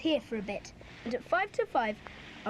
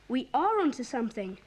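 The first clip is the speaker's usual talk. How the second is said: usual speaking voice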